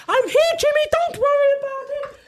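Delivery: very loud, high-pitched shouted entrance; acting out an over-the-top friend arriving; full blast. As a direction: bellow it at the top of your range. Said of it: "he's either full blast or he's down".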